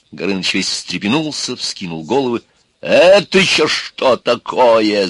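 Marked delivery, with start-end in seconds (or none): 2.8-5.1 громкое, медленное, возмущённое шипение; растягивать слова, как встревоженный змей; злое шипение. A narrator's reading. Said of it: "зашипел он". (зашипеть с негодованием)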